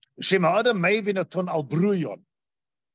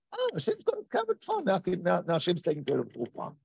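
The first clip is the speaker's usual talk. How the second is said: lightly mock the lazy truster's shrug; mock complacent; 6 dB quieter than usual. Mocking complacent false trust.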